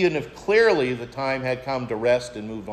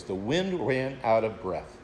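softer, lower, lingering on wind; preacher repeating a favourite phrase to the congregation, softly savored; savoring the phrase; 6 semitones lower, 7 dB quieter than usual. Shown as a demonstration. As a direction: let "wind" sink quietly, relishing the phrase. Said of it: "I love that phrase".

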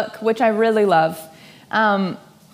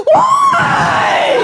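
very high, loud crying wail; mock anguished why; 8 dB louder than usual. Single daters asking God why, mock wail. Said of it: "And you're like".

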